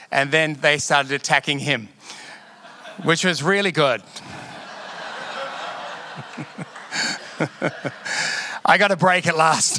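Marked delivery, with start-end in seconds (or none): 4.1-8.6 long breathy real laugh. Real, not acted. Pastor laughs at his own office story. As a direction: laugh helplessly at the irony.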